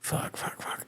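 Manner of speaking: unvoiced panicked whisper; breathless whispered fuck fuck fuck; whispered curse. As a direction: whisper the curses as the bike slides. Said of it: "and I'm like".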